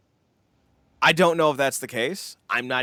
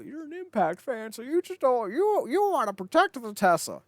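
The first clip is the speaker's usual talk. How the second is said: lightly voiced mocking critic; a hint of a sneering accuser; 8 semitones higher than usual. Scene: mocking critics who accuse him of bias, lightly voiced sneer. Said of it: "don't even come at me with the whole".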